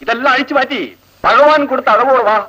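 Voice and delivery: high, sneering mock official; mock minister. Seniors ragging juniors, high sneering mock authority. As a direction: lord it over them with a sneer. Mocking ragging of new girls.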